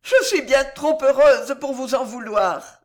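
high, loud, joyful reply; happy forgiveness. A narrator's reading. Girl forgives Pardaillan, high and joyful. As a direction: forgive him with overflowing happiness. Juana acted happily forgiving the chevalier.

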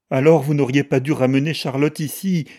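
high, loud, plaintive outcry; lamenting cry. A narrator's reading. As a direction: wail a complaint at the household. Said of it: "s'écria Georgie d'un ton lamentable".

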